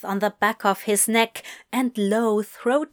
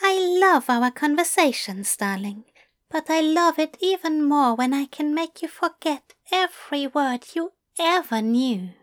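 higher, fond and smug angel voice; Aziraphale's voice; 5 semitones higher than usual. Acted. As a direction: tease him lightly, a touch higher.

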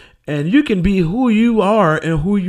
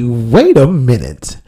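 normal talking voice

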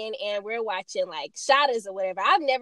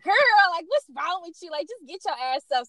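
exasperated friend; 7 semitones higher than usual. Retold or imitated. Friend's exasperated reply in story.

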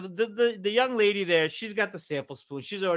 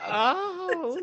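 long, high-pitched "oh"; mock aroused moan; 13 semitones higher than usual. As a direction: imitate her swooning with a drawn-out high moan. Retold mother's sudden aroused reaction.

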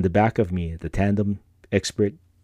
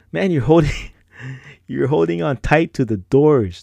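tandem guy ribbing; 6 semitones higher, 5 dB louder than usual. Retold or imitated. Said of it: "he goes".

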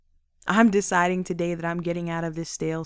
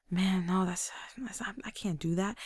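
breathless whisper, much softer; whispered denial; 10 dB quieter than usual. Pretend. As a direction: wave off the praise in a whisper. Brushing off praise, unvoiced.

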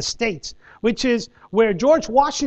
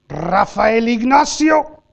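slow, louder full-name call; upset mom calling full name; 4 dB louder, much slower than usual. Retold or imitated. Angry mom calls her son to the back of the bus, slow and loud. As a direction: drag out each name, warning. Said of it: "I know when my mom's upset".

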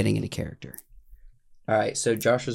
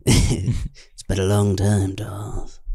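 slow, deliberate villain greeting; slow dramatic line; 3 semitones lower, much slower than usual. Acted. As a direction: draw it out slowly and gravely. Reads Star Wars script line.

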